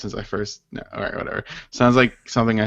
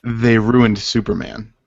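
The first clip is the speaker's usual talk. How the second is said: slow title read; 3 dB louder, slower than usual. Real, not acted. Reading out title candidates.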